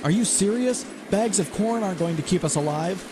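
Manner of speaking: high disbelieving protest; incredulous. Acted. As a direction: push back in disbelief. Arguing in a crashing plane.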